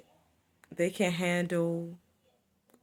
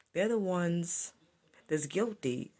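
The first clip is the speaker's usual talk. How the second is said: ordinary talk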